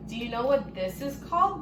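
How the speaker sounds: plain reading voice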